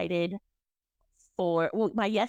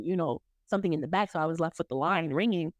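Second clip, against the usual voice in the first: normal talking voice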